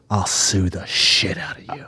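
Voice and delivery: low, slow menacing whisper; whispered threat. Retold or imitated. Angry driver threatens to sue him, low slow whisper. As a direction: hiss the threat under your breath. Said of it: "And he goes".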